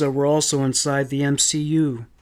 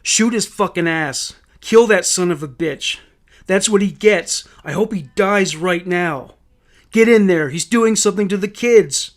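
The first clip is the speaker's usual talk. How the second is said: furious, urgent shout at police; heated raised-voice outburst; 4 semitones higher, 6 dB louder than usual. A narrator's reading. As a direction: yell it louder and higher, spurring them to act. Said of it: "Louis shouted at the police".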